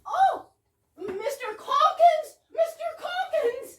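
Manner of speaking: high, loud shriek of a name; shrill hammed-up shriek of Mister Calkins; shrieking cry. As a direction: scream his name in alarm.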